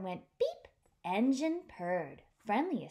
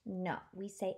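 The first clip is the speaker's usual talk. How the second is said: usual speaking voice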